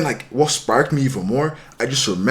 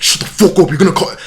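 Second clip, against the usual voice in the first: louder, higher imitated outburst; retold angry order; 6 semitones higher, 7 dB louder than usual. Telling the begging guy to shut up, angry. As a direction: cut him off forcefully, as retold.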